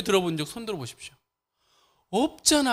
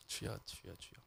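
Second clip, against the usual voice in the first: whisper; 10 semitones lower, 20 dB quieter than usual. Real, not acted. Calming himself after loud preaching.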